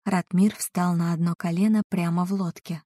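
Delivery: ordinary delivery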